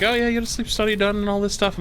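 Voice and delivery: mock-cheery receptionist pitch, pushed up high; imitating the specialist. Retelling the specialist greeting her patient, mock-chirpy and high.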